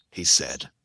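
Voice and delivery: ordinary delivery, nothing marked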